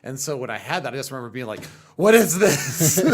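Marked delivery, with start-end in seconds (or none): 2.0-3.0 hammed-up, drawn-out cry of astonishment; much louder and higher, stretched out in mock shock; overwhelmed bewilderment. Acts out overwhelming new feeling.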